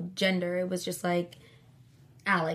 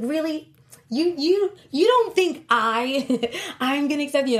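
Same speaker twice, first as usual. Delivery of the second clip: mock indignation; 7 semitones higher, 8 dB louder than usual. Indignant that cousin doubted her.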